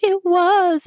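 high-pitched pleading imitation; mock begging. She begs on her knees about the belt, mock pleading. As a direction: plead it high, as a hammed-up retelling. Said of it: "she's begging on her knees".

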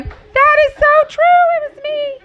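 wife reading a book that describes her own marriage; excited recognition. Retold or imitated. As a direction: cry out in delighted recognition. Laughing at reading her own story.

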